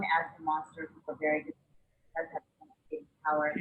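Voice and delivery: faint, muffled line audio. Real, not acted. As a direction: sound as if a hand covers the mouth. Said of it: "it was a bit muffled".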